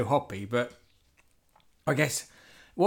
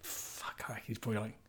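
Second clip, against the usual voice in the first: whisper; 4 semitones lower, 17 dB quieter than usual. Real, not acted. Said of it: "pub quiz guess".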